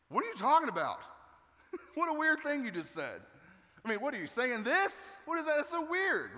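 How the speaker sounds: high-pitched, incredulous questioning; baffled disbelief. Acted. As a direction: act utterly thrown by a weird remark. Acted baffled reaction to Jesus.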